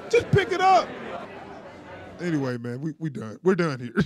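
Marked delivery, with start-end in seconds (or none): 0.1-0.9 high exasperated outburst. Real, not acted. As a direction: throw up your hands, pitch up. Exasperated at tongs.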